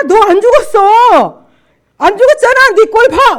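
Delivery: high, very loud taunting yell; out-shout your opponent as the jeering devil. Retold or imitated. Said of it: "더 막 큰 소리 하는 거예요".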